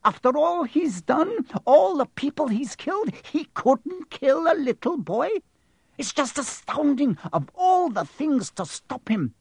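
high, tearful astonishment; distressed, high-pitched disbelief; faltering, distraught. A narrator's reading. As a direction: keep it high and near tears. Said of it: "faltered Professor McGonagall".

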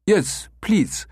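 an eager German tourist; broken German English. A narrator's reading. Becker as German asking for an escort, broken English. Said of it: "Becker continued in broken English".

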